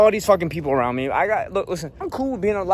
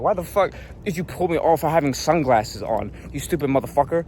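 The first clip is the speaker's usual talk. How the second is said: usual speaking voice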